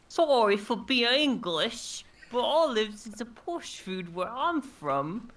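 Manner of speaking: high, slow mock West Country accent. Apologising to Mark in a put-on West Country accent. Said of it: "I apologize to Mark Zalmans for what I'm about to do".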